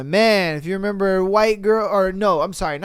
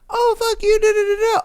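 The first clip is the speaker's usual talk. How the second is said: much higher mock squeal; imitating inner rage; 14 semitones higher than usual. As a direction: squeak out the silent curse. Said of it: "They'd be sitting there just like".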